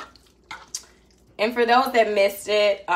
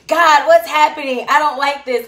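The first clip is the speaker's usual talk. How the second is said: high, loud mock panic; a loud playful cry of dismay; complaining to God; 8 semitones higher, 10 dB louder than usual. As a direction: whine it up at God, flustered and loud. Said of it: "you're like".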